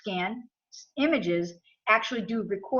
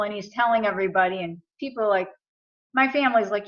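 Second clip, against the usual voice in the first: ordinary talk, nothing marked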